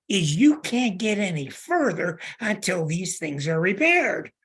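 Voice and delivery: ordinary delivery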